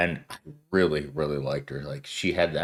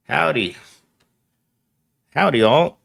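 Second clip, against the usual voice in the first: cowboy howdy; 7 semitones higher, 7 dB louder than usual. Acted. Greeting viewers in Western style.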